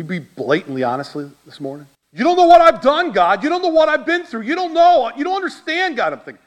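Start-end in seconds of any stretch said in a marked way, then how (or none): 2.1-6.1 much higher, exasperated pleading with God; pleading argument. Retold or imitated. Preacher retells arguing with God, high and pleading. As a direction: push back at God, protesting. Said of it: "And so I began to argue".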